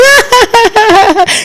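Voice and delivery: high airy laugh. Real, not acted. Planner laughing at her own toe joke, high laugh.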